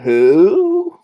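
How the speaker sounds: high, loud hooting owl. Acted. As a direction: hoot it like an owl. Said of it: "He went".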